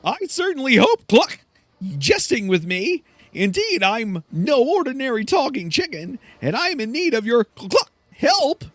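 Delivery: high-pitched talking chicken with clucks; squawky, pompous chicken in need. Acted. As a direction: plead grandly, clucking mid-sentence. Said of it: "my [name] voice".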